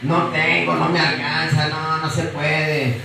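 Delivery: mocking a believer who won't try; whiny excuses. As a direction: drag out no se puede like a sulky complainer. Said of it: "tú no quieres poner de tu parte".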